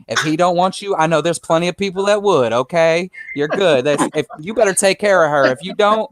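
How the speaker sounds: sassy retold auntie voice; older lady. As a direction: play her protective and mock-threatening. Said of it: "this older lady".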